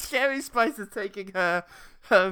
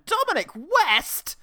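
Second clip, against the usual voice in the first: incredulous reveal; 13 semitones higher, 5 dB louder, slower than usual. Acted. Announcing cameo, amazed.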